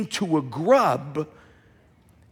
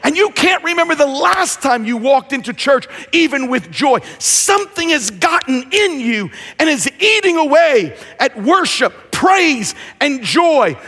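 preaching; 4 semitones higher than usual. Acted. Preacher builds on worship lost.

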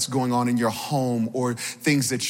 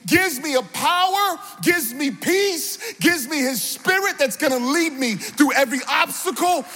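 high, rousing sermon delivery; impassioned preacher's call; preaching; 10 semitones higher than usual. Real, not acted. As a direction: exhort the congregation in rhythmic, falling phrases. Preaches with raised voice.

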